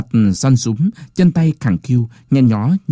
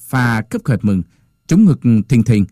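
plain reading voice

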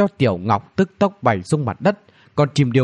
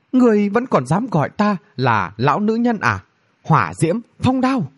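high-pitched offended retort; acted outrage, pitched up; indignant sorceress; 8 semitones higher than usual. A narrator's reading. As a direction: take offence, then call out the spells. Offended woman casts attack spells.

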